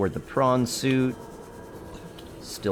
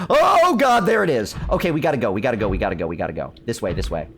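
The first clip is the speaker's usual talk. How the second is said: panicked shout fading to hurried urging; alarmed cry, then rushed retreat; being chased; faster than usual. Real, not acted. Leviathan appears, fleeing.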